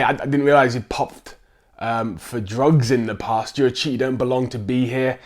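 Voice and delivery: ordinary talk, nothing marked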